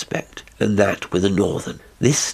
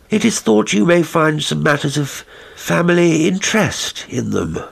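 higher, earnest voice of the messenger; hurried young visitor; 7 semitones higher than usual. Acted. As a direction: explain politely but pressed for time. Crome explains his haste.

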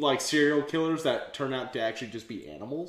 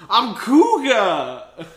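loud, drawn-out hero cry; hammed-up superhero introduction; heroic announcement; 9 semitones higher, 11 dB louder, much slower than usual. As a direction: proclaim it slowly and high. Voicing the hero announcing himself.

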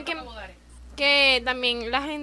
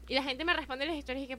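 ordinary talk

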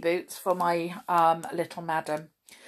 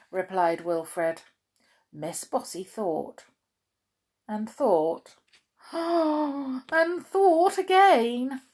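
usual speaking voice

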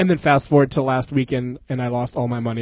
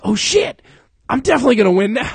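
high, fast burst of gambler's glee; excited; 8 semitones higher, 3 dB louder than usual. Retold or imitated. To his friends at the counter, hyped and rushing. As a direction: rattle it off, sure you can't lose. Overconfident after winning.

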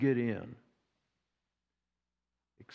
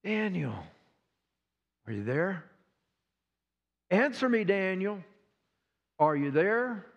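mild pleading call into the dark; calling out for Daniel, worried; anguished calling; 4 semitones higher than usual. Acted. King calling to Daniel in the den, anxious.

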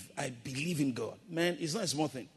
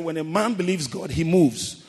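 louder, driving the point home; preacher repeats it with more force to the congregation, louder; 1 emphatic repeat; 3 semitones higher, 11 dB louder than usual. Shown as a demonstration. Preacher repeating his line to the congregation, louder and emphatic. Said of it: "I said".

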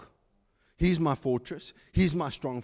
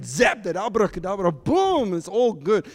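louder, higher mock magic flourish; mocking quick fix; 5 semitones higher, 5 dB louder than usual. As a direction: toss off the magic word with a boom.

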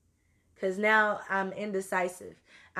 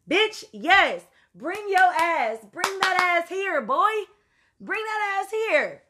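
higher and louder, demanding he come now; exasperated yelling; 9 semitones higher, 5 dB louder, slower than usual. Retold or imitated. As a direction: shout at him to get over here. Demanding friend come over.

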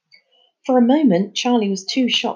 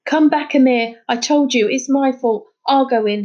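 louder desperate call to his friend; shouting; 4 semitones higher, 5 dB louder than usual. Acted. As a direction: call him back in desperation. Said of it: "he shouted".

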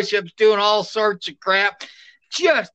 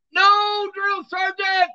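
recruit's shout; 12 semitones higher, slower than usual. Retold or imitated. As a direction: yell it straight ahead at attention.

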